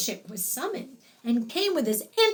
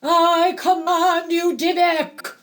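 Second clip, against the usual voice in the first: loud, raised, forceful command; commanding exorcist; 6 semitones higher, 7 dB louder than usual. A narrator's reading. Rabbi orders the dybbuk out, commanding loudly. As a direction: boom it out at the spirit.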